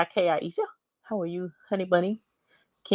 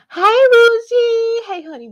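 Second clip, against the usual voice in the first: delighted squeal; 11 dB louder than usual. Real, not acted. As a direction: squeal her name with joy. Delighted squeal greeting a viewer.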